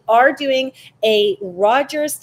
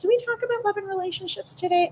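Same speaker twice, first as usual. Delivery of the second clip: high quick aside; 10 semitones higher, 3 dB quieter, faster than usual. Real, not acted. Self-questioning aside.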